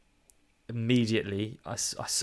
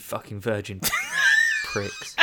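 laughing mock insult; 4 dB louder than usual. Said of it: "followed up with something offensive".

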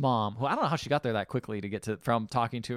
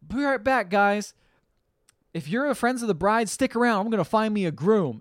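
brassy bride; 8 semitones higher, 6 dB louder than usual. Retold or imitated. Bride's brassy announcement to guests.

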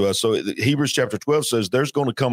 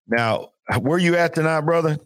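ordinary delivery

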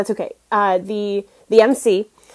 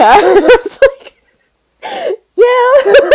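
high, loud helpless laughter; laughing through the words; laughing hard; 10 dB louder, much slower than usual. Real, not acted. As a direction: crack up while saying it. Laughing retelling.

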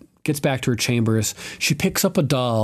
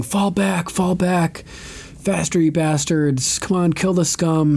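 retold screaming; 6 semitones higher, slower than usual. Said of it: "You can hear people screaming".

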